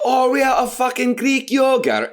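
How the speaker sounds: high, hammed-up bad Scottish accent. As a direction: shout it in a mock Scottish accent. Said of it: "me doing a bad Scottish accent".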